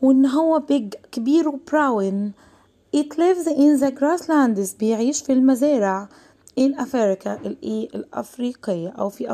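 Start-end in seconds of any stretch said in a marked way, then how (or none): 3.0-4.8 very slow, word-by-word classroom reading; slow reading aloud. Shown as a demonstration. Teacher reading the lion text aloud to the class. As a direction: read it out slowly for young learners. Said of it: "rereading sentence".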